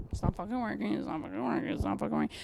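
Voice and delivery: soft, repeated frustrated undertone; frustrated muttering. Retold or imitated. Failing the drill again and again, quietly frustrated.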